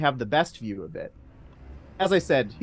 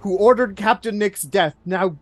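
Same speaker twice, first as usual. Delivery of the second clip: loud, higher enraged accusation; furious mayor; 8 semitones higher, 9 dB louder than usual. Acted. Mayor accusing Greenback before the room, loud rage. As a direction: roar it at the hidden villain.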